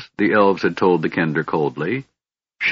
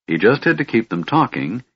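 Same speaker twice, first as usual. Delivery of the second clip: usual speaking voice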